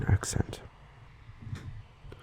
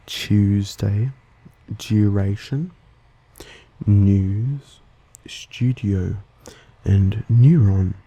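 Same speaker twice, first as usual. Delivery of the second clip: Australian "yoo" glide in "news" and "neuron"; Australian accent; much slower than usual. Shown as a demonstration. Host showing own accent, Australian "yoo" sound. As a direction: say "Tuesday" and "duration" with a J-like glide, Australian style. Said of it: "unlike in my accent".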